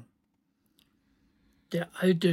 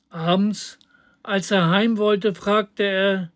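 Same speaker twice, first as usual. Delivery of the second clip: plain reading voice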